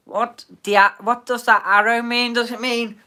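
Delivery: high, loud, startled question; suddenly baffled and shouting; surprised outburst. Real, not acted. Suddenly confused by the arrow.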